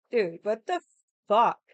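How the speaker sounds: loud, incredulous what the fuck; outraged. Real, not acted. Reacting to brother's Disneyland honeymoon, loud disbelief. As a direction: spit out the last word in disbelief.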